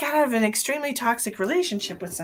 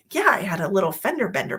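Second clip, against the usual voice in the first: ordinary talk, nothing marked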